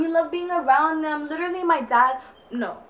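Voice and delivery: ordinary talk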